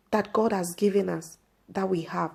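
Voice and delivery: ordinary delivery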